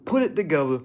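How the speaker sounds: usual speaking voice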